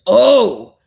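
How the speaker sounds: sudden loud "oh"; loud startled oh. Retold or imitated. The big giant reacting to the smash with a loud startled cry. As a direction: blurt it out, caught off guard.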